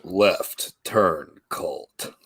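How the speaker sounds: low, very slow robot voice; robotic announcer voice. Shown as a demonstration. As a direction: draw it out low like a machine. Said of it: "Do it for us live".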